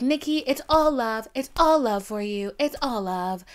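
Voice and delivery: a hint of sugary insincerity. Imitating [name]'s reply.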